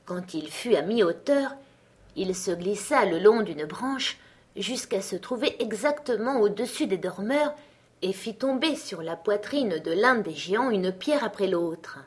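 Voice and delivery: ordinary delivery, nothing marked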